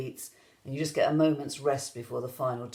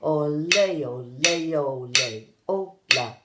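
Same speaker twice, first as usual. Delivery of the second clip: gradually speeding up; 4 dB louder than usual. Shown as a demonstration. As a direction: start measured and steadily tighten the beat. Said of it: "gradually speed up".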